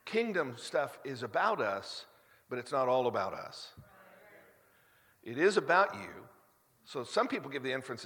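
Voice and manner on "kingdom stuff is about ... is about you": emphatic preaching cadence. Acted. Pastor preaching to his church, emphatic. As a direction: preach it to the congregation with force.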